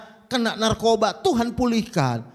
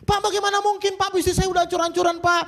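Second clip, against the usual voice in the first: much higher, fast anguished protest; despairing wife; 9 semitones higher, faster than usual. Retold or imitated. Betrayed wife pleading with the pastor, high and fast. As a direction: plead that it's hopeless, rushing. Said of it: "Kalau Anda berkata".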